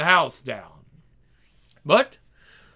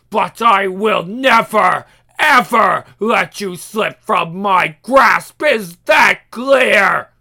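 menacing shout; 3 semitones higher, 8 dB louder, slower than usual. Acted. Demon threatening boy.

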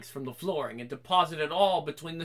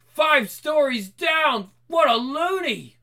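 higher, louder, slow outcry; shocked exclaim; 8 semitones higher, 6 dB louder, slower than usual. Acted. Doctors react to the patient's jump. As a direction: exclaim in disbelief.

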